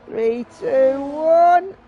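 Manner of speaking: rising, building countdown to the line; excited countdown. Real, not acted. Countdown to the lap finish.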